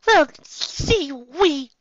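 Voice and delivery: high, loud, halting lady voice; stuttering Rarity. Acted. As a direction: stumble over the words. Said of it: "Rarity stuttered".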